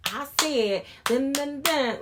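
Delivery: mock-dramatic loud chant; playful TikTok imitation. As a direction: belt it out like a child copying a TikTok. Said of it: "when my daughter be playing".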